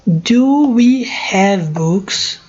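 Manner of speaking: each word slow and separate; slow model sentence. Shown as a demonstration. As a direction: spell it out slowly for students. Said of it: "Tô dando pausa pra vocês pronunciarem". (bem devagar, palavra por palavra)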